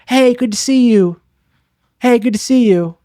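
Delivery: fake friendly greeting.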